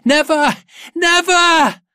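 very high, full defiant scream; child's shout. A narrator's reading. Child shouts into the night sky, high defiant shouting. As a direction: shout "Never" to the skies as a child. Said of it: "The child shouts into the night sky".

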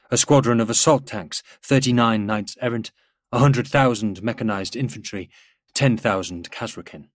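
ordinary delivery, nothing marked

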